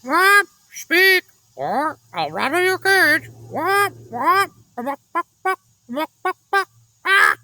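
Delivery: high, loud parrot screech; squawking parrot yelling threats; screaming parrot. A narrator's reading. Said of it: "Then the parrot screamed".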